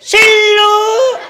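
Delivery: very high, loud, long call upward; shouting for wife. Retold or imitated. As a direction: call her from down below. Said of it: "આજુબાજુ કોઈ છે નહીં ને?". (નીચેથી પત્નીને ઊંચા મોટા અવાજે બૂમ)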